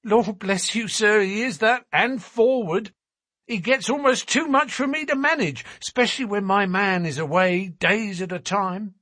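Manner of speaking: high-pitched, talkative Mrs. Smith, fondly complaining; chatty working-class mother. A narrator's reading. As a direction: prattle proudly about a forward child. Narrator plays the boy's mother.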